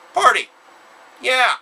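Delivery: high, loud party cry; short, punchy high-pitched cheer; party shout. Acted. Party cry after translating album title.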